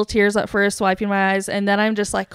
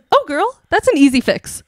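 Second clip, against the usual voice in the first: put-on higher, breezy 'oh, girl' confidence; breezy reassurance; 6 semitones higher, 3 dB louder than usual. Ticket booth angel to crying guest, breezy reassurance. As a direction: wave the problem away.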